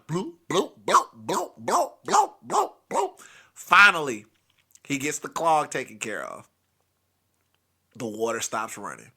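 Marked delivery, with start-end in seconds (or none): 0.1-3.2 bloops climbing higher and higher; plunger noise. Retold or imitated. Storyteller mimics grandpa's plunger, rising rhythmic bloops. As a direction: imitate plunging, each bloop rising in pitch. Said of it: "It just plunged".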